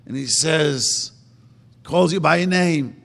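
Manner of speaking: ordinary talk, nothing marked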